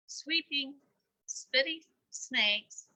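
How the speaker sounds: usual speaking voice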